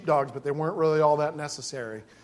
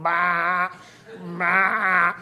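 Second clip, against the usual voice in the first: imitated sheep bleating "bah"; drawn-out mock bleat. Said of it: "this one sheep going".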